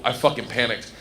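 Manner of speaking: ordinary delivery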